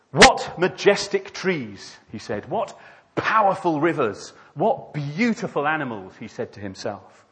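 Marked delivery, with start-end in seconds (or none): 0.1-2.0 slow, high, loud marvelling; admiring exclamation. Acted. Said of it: "Admiring all that the". 2.5-6.2 raised, emphatic marvelling; hammed-up wonder; admiring exclamation. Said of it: "Admiring all that the".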